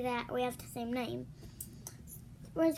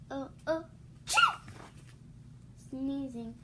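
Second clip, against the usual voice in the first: usual speaking voice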